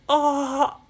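high, loud acted cry; short scream of alarm. Brady lands on a merflin, alarmed scream. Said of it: "like that".

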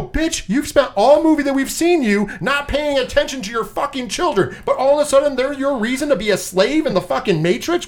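high, indignant rant at the character; mock rant. Ranting at Bunny over her neglected kids, high indignation.

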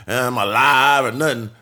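normal talking voice

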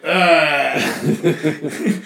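groggy laughing grunt; drunk grunt. Retold or imitated. Shoe hits sleeping drunk, groggy grunt. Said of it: "he was just like".

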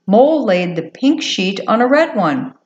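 ordinary delivery, nothing marked

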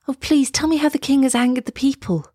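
begging. A narrator's reading. Girl begs fisherman for news of the king, pleading. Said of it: "begged Elsa".

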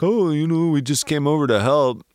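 a hint of a meek, helpful scarecrow; sheepish Scarecrow. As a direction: Scarecrow sheepishly explaining to the Tin Man. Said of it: "the Scarecrow could be like".

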